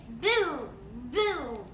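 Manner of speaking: loud booming drum sound. Acted. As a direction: boom it out like a drum. Bean plays his drum.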